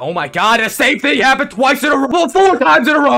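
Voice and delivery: high, loud, rising yell; raging at the game; exasperated shouting. Real, not acted. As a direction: build the outrage to a shout. Repeated failure in game.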